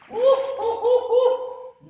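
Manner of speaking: high whooping scream; screaming woo. Real, not acted. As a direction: let out loud whoops. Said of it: "You like screaming yet?".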